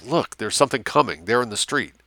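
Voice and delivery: usual speaking voice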